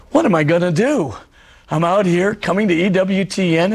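ordinary delivery

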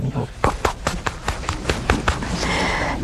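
slow, breathy, whispered pops; unvoiced pop-pop of hands slapping an alarm; mock alarm slaps. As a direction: tap out each pop slowly under your breath.

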